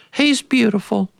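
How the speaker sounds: high, far-off doting voice; doting mother. Retold or imitated. Mother answering her sisters, high and doting. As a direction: sigh it out like a besotted mother. Mother dotes on son's curls.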